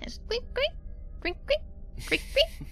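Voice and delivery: high squeaking cart wheels; squeaky wheel noise. Retold or imitated. Imitating squeaky mail cart wheels.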